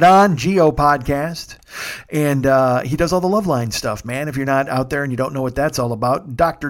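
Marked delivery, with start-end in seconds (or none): none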